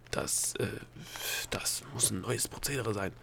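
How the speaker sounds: soft, halting stammer. A narrator's reading. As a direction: falter softly through the words. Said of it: "stammelte der Gnom".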